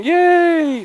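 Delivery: high, loud yay; excited cheer. Real, not acted. Cheering as the controls work.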